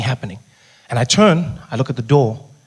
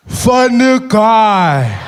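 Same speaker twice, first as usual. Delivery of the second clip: Julius Malema voice; 5 semitones higher, 7 dB louder, much slower than usual. Retold or imitated. Malema staring him down at his table, slow and heavy. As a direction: stretch it out, sizing him up. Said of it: "looks me straight in the eye and goes".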